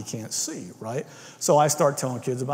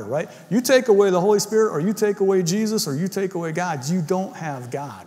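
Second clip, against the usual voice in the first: full-force preaching; 5 semitones higher than usual. Acted. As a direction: hammer each point home to the church.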